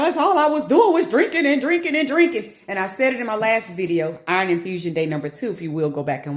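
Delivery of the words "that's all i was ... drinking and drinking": high, loud exasperated protest; high emphatic insistence. Retold or imitated. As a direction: plead your innocence, pitched up. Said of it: "I was like".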